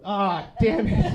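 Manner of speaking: loud, high, drawn-out mock frustration; exasperated outburst. Uncle conned out of five dollars, loudly exasperated. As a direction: howl it slowly, realizing you've been had.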